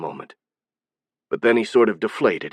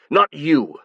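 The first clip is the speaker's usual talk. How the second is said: shouting; 5 semitones higher than usual. Acted. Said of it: "Azen shouted".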